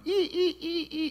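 high squeaky ee ee ee, soft; squeaky fitting noise. Retold or imitated. Preacher mimics Jesus fitting the ear back, squeaky high noises. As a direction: squeak like fiddling a part into place.

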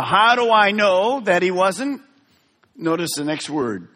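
ordinary talk

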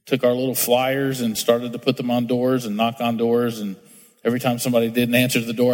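usual speaking voice

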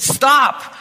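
loud, high, pleading cry; a child begging it to stop; desperate cry. A narrator's reading. Boy begs as blows fall.

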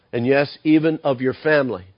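normal talking voice

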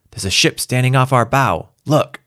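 high, urgent warning; alarmed pilot. Acted. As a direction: cry out the sighting urgently.

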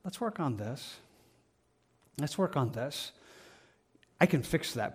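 soft, tender encouragement; softly reassuring, a touch of gentleness; gentle voice. Retold or imitated. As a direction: speak like a kind mentor, hushed. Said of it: "again, a gentleness".

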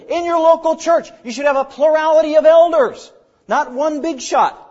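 high, loud preaching voice, building; emphatic preaching. Real, not acted. Preacher urging a plurality of elders on the church, forceful. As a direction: build in force as you press the scripture home.

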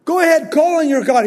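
quick, high taunting dare; mocking dare. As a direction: toss it off fast like a fearless prophet goading them. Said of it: "He's just standing back mocking them".

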